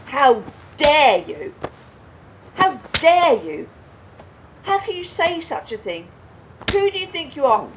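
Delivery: loud, high, outraged shouting at her husband; furious outrage. Acted. Wife furious at her husband's accusation, shouting.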